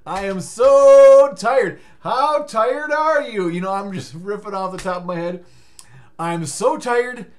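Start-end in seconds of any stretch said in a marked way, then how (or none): 0.1-3.5 slow, high, loud stretched complaint; mock dramatic whine. 6.2-7.3 slow, high, loud stretched complaint; mock dramatic whine.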